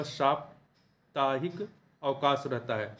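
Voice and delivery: साप्ताहिक with a long सा, slowly and softly; slow correct form. Shown as a demonstration. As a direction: spell it out slowly. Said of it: "सा पे आ की मात्रा होगा". (धीरे, साफ़-साफ़)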